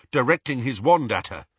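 usual speaking voice

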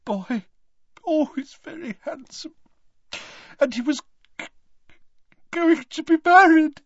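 high, broken voice catching on words; sobbing mother. A narrator's reading. Mother weeping over her wounded son, high and broken. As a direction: weep over her son's face, words breaking. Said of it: "She began to sob".

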